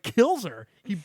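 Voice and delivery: ordinary talk, nothing marked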